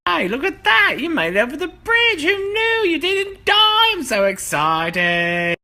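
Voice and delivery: high, loud excited squeal; excited shout. Acted. Man greeting the rabbit over the bridge, excited shout. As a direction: squeal it in delight.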